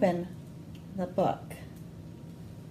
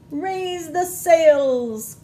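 high, loud, very slow order; pirate command; 8 semitones higher, 7 dB louder, much slower than usual. Acted. Little pirates commanding in the tub, slow loud high order. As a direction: stretch it out like a captain's order. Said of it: "command her little pirates".

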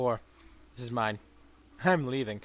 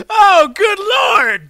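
high loud drawn-out exclaim; laughing outburst; 14 dB louder, much slower than usual. Real, not acted. Laughing at his own nipple rectum joke. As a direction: burst out through laughter.

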